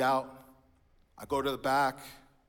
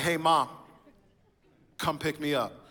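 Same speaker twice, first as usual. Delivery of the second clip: louder, blunt order to Mom; rude demand; 4 semitones higher, 6 dB louder than usual. Retold or imitated. Teen son demanding his mom pick him up, rude and loud. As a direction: bark it like a bossy teen. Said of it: "That's so rude".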